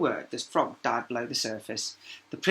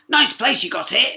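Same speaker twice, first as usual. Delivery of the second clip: higher, louder frog; approving frog; 10 semitones higher, 11 dB louder than usual. A narrator's reading. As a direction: admire the room as the frog. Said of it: "it said approvingly".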